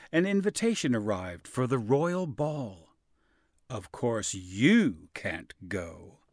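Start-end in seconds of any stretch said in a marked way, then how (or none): 3.7-6.2 slow, low, cold stepmother voice; wicked stepmother. A narrator's reading. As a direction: sneer it slowly as the cruel stepmother. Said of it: "said the stepmother".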